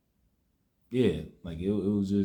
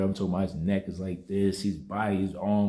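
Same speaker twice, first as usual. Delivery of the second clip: usual speaking voice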